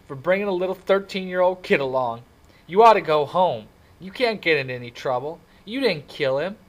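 high, loud, miserable self-reproach; miserable outburst. A narrator's reading. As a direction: pour out guilt at full voice. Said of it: "said in a miserable voice".

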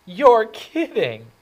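high loud drawn-out surprise; mock surprise. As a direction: exclaim in hammed-up shock. The remarks I usually get.

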